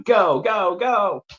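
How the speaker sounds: high, eager go go go; excited countdown. Real, not acted. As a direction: fire off the start of the race. Starting the giveaway question race.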